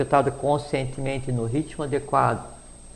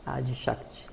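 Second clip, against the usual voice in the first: Adi Shakti much softer, falling away at the end; soft repeat; 10 dB quieter than usual. Shown as a demonstration. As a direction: let Adi Shakti drop to a soft echo. Repeats the name softly. (Adi Shakti repetido baixinho)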